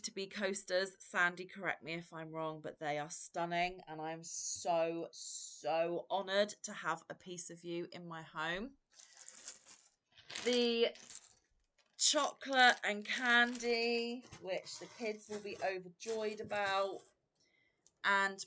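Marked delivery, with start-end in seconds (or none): none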